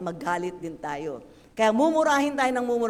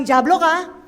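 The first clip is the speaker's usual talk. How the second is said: mock insult; 4 semitones higher, 11 dB louder than usual. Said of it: "Hindi mo sinabing".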